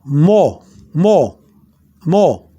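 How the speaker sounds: Mo with the second O blended into the first; two O's blended. Shown as a demonstration. Teacher repeating for learners to hear the second O, blended double O in Mo. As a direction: run two O's together in "Mo". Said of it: "But you're blending it together".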